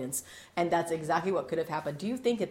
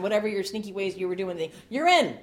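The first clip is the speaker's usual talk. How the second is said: normal talking voice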